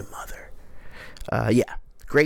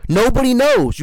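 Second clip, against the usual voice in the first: higher and louder outburst; irritated louder burst; exasperated; 9 semitones higher, 7 dB louder than usual. Real, not acted. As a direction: throw up your hands at the filler. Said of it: "I'm really mad at myself".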